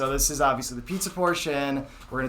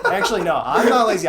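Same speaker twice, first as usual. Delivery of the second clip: laughing; 7 semitones higher, 8 dB louder than usual. Real, not acted. Laughing denial of being lazy.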